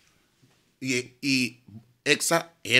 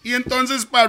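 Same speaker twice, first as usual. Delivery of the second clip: usual speaking voice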